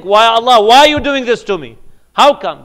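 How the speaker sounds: high, loud anguished complaint; plaintive loud protest; complaining cry. Acted. Preacher voices a complaining believer.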